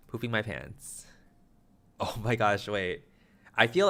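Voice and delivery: ordinary delivery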